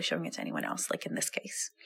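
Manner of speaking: whispered aside. Real, not acted. Host whispers an aside to listeners about the exercise. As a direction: drop to a secretive whisper.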